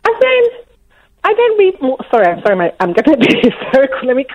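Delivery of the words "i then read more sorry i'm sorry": ordinary delivery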